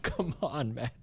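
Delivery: real laugh breaking into the words. Real, not acted. Co-host reacting to a derby mishap he shouldn't find funny, laughing. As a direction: say it through a guilty laugh. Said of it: "I shouldn't laugh".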